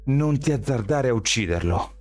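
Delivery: high, firm warning; warning order. A narrator's reading. Shelby warns Miles not to kill Daniel, high firm order. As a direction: order him not to dare. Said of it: "intimò Shelby a Miles".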